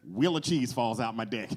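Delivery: ordinary delivery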